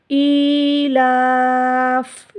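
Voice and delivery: ईलाफ़ with the ला held extra long, ending on a stopped फ़; pause form stretched. Shown as a demonstration. Teacher showing the pause, long and drawn. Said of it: "इस तरह".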